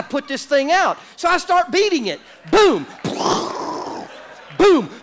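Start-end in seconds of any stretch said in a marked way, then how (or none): 2.5-4.9 sharp loud booming hits. Retold or imitated. Preacher acting out beating the fire, loud booms. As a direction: slam each boom out loud.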